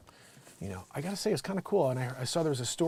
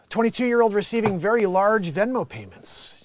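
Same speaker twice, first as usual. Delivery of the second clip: loud, raised read-out voice; letter read aloud; 9 semitones higher, 11 dB louder than usual. Acted. Host reads a Dear Abby headline, projected. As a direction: announce the letter to the audience.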